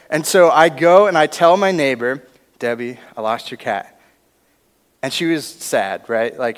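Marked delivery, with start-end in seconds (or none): 2.6-4.0 sheepish confession. Retold or imitated. Confessing lost cat to neighbor.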